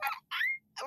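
very high, airy "Ah"; dad barely reacting; flat dad reaction. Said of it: "My dad is very emotionless".

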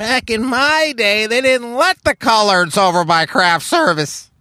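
put-on high, slow old man reminiscing; slow, high creaky old-timer telling tales. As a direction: play a crusty Hollywood old-timer. Old showbiz retiree voice.

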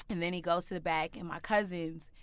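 ordinary talk, nothing marked

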